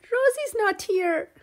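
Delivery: put-on high, loud child's cry of alarm. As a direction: call out in sudden panic. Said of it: "she cries out".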